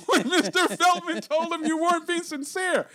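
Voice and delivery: laughing through the words, loud and high. Real, not acted. Teasing his co-host about Feltman, laughing. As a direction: tease him while cracking up.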